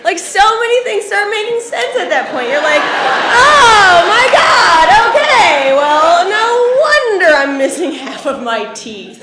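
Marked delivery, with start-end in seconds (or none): 2.8-9.2 high, loud comic outburst; mock epiphany. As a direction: shout it as everything suddenly makes sense. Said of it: "you think to yourself".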